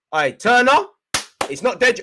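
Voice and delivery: high, loud call to the keeper; urgent shout. Real, not acted. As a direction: yell a warning to a player. Calling to goalkeeper in alarm.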